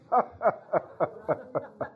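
long rhythmic chuckle; laugh. Real, not acted. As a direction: laugh in a steady run of pulses. Preacher laughs mid-sermon.

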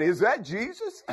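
high, eager shout of discovery; excited blind man. Acted. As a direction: call out amazed, with laughter breaking in. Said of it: "he got excited".

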